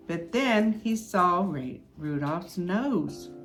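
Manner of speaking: ordinary delivery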